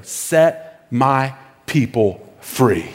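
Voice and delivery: slow, weighty, word by word; slow emphatic command. Retold or imitated. God commanding Pharaoh through Moses, slow solemn command. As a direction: space each word out, low and firm.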